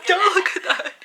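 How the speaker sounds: laughing through the words; giggly plea to the camera. Real, not acted. Repeats, laughing harder.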